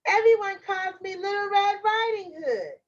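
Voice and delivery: put-on high-pitched little girl. Girl tells the wolf her name, high little-girl voice. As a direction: answer brightly in a high child's voice. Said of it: "said the little girl".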